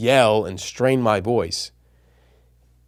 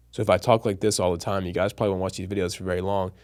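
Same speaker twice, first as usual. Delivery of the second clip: lower, softer, one-note delivery; monotone demo; 5 semitones lower, 6 dB quieter, faster than usual. Shown as a demonstration. Showing viewers how boring speech sounds, lower monotone. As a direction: drone it out with no melody at all. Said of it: "meaning you're changing of pitch".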